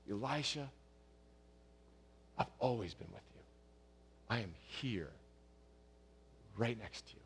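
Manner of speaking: quiet comfort, close to the listener's ear; gentle compassionate voice. Retold or imitated. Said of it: "in a gentle, compassionate, still voice".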